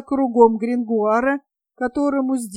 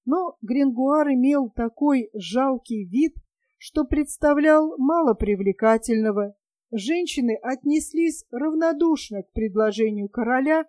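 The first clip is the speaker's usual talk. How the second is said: ordinary delivery, nothing marked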